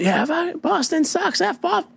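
high, mocking jeer at Boston; high taunting heckler voice. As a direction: imitate the heckler sneering at Boston. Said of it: "He goes".

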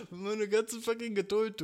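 a fed-up host laughing at his lost patience. Real, not acted. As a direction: laugh through the line. Laughing, louder.

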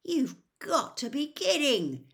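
high, loud incredulous protest; disbelief. A narrator's reading. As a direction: protest as if you can't believe it. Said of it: "said Ron in disbelief".

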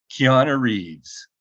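ordinary delivery

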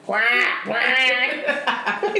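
imitated duck squawk; drawn-out mimicked quacking. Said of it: "It's like a duck".

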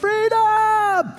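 a huge, high-pitched yelled cry with a laugh in it; Braveheart battle cry. Retold or imitated. Pastor retelling running the Stirling field, yelling a Braveheart battle cry. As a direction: belt it out like William Wallace on the battlefield. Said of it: "run around the field of Stirling going".

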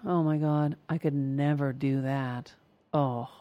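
low, soft and slow self-doubt; hushed, low resignation; defeated inner voice. Acted. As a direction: sink into quiet discouragement. Acted inner voice giving up in envy.

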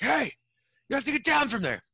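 high-pitched mock guard's yell; imitating an alarmed security guard; calling out. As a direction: call up to someone on a fence. Guard calls up to climber.

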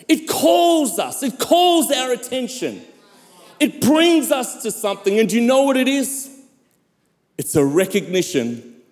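emphatic sermon delivery with long dramatic pauses; preaching. Acted. Pastor telling the church of a healing miracle, preaching. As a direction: preach it in swelling, weighted phrases.

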